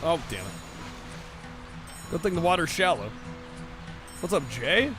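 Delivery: usual speaking voice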